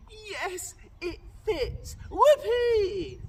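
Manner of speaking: high, very loud gleeful scream. Acted. Ugly sister when the shoe fits, high very loud gleeful scream. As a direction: shriek with triumph. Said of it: "she screamed".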